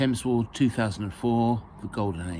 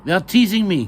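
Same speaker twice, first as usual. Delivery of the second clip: high, loud protest; crying out; 9 semitones higher, 9 dB louder than usual. Acted. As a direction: cry out a complaint to the nurse. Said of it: "cried Maya".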